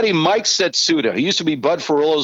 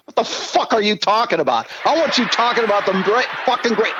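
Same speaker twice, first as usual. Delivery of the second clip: higher, ranting boss tirade; furious boss; 7 semitones higher, faster than usual. Retold or imitated. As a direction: yell at him like a furious owner. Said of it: "he is just dropping F-bomb".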